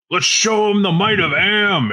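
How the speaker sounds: high, drawn-out rallying call, not shouted; rallying battle cry. Acted. Character's battle cry at the table.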